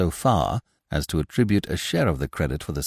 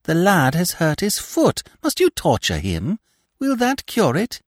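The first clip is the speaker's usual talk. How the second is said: higher-voiced indignant protest; reproving daughter; 10 semitones higher than usual. A narrator's reading. Climene defending the lad to her father, reproachful. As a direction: plead crossly, pitched high. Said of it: "she reproved her father".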